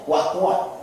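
ordinary talk, nothing marked

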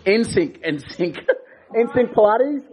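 laughing through the name. Real, not acted. Repeating the instructor's studio name, laughing. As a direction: chuckle as you repeat it.

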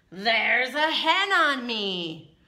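slow, loud pig complaint; pig character. A narrator's reading. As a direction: stretch it out loud as a put-upon pig. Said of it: "said the pig".

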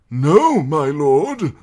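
put-on high, loud quavering old voice; frail scholar lecturing the court; old maester. A narrator's reading. Pycelle voiced in narration.

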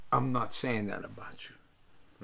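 slow whispered gruff line; whispered refusal. Retold or imitated. Father telling his son, in a slow whisper. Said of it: "But he said".

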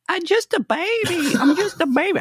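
very high, squeaky cartoon baby voice; mocking a whiny man with the TikTok baby voice; tiny baby voice. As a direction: imitate the little TikTok baby. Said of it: "they play the little voice".

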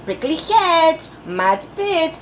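put-on slow, drawn-out list for kids to repeat; teacher-paced recital; slow call and response. Shown as a demonstration. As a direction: stretch each place name out. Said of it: "Say with me".

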